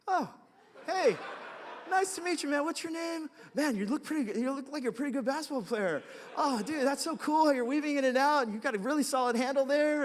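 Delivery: high, loud, sheepish over-friendliness; overly friendly gush. Retold or imitated. Pastor suddenly switches from fuming to gushing, high and loud. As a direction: overcompensate with sudden cheer. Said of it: "immediately I was just like".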